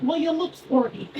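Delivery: high-pitched first-grader voice; Tommy. Retold or imitated. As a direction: imitate a blunt little kid. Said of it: "He's like".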